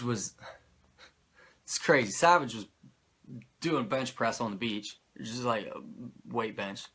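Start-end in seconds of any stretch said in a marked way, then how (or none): none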